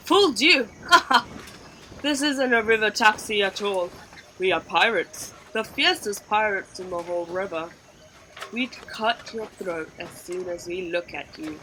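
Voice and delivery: loud, laughing villain boast; gloating pirate. A narrator's reading. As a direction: crow over the fooled girl. Pirate captain reveals the trick.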